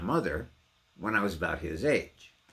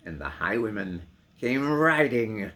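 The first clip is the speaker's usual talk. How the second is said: loud, driving and rhythmic; ringing refrain; 5 semitones higher, 9 dB louder than usual. Acted. Reading the poem aloud, calling out the rider loud. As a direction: let the horse gallop in the words.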